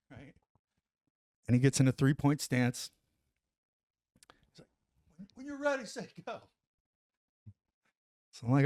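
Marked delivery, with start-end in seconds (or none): 5.2-6.4 high, soft put-on voice for his friend; imitating buddy. Buddy asking if he's ready to time him, high soft mock voice. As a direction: pitch it way up and hush it.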